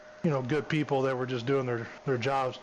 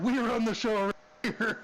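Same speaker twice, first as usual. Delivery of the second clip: retold yell; 8 semitones higher than usual. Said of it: "She, like, screamed".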